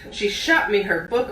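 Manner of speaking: ordinary delivery, nothing marked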